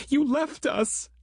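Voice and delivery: high, slow, furious screamed accusation; screaming accusation. Acted. Christine screams at Grant for leaving them behind, high and furious. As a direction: hurl the blame at him. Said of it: "Christine screamed".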